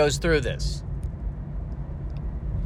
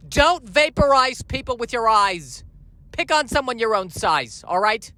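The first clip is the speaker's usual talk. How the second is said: higher-pitched New York scolding; New York parent laying down the law; 6 semitones higher than usual. Acted. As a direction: scold your son with a New York accent.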